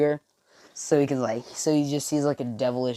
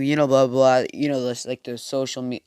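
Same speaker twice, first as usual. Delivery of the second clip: ordinary delivery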